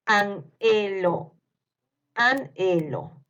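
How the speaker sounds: slow "Anhelo", an held apart from helo; dictation. Shown as a demonstration. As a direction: dictate "Anhelo" in stretched syllables. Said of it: "les voy a ir dictando". (dictado lento, separando "Anhelo")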